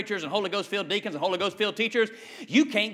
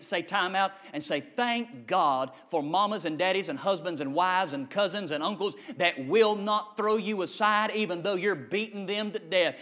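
ordinary talk

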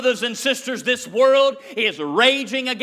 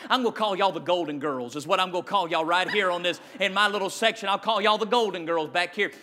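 quick aside; 3 semitones lower, 4 dB quieter, much faster than usual. Real, not acted. Fast quiet joking aside.

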